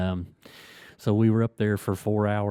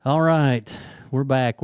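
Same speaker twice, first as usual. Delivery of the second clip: louder, higher show-host return; back-from-break radio host call; announcer welcome back; 4 semitones higher, 6 dB louder, slower than usual. Real, not acted. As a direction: bounce back on air with energy.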